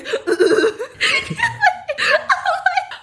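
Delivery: 터져 나오는 웃음소리. Real, not acted. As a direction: burst out laughing, high. Hearing him throw up.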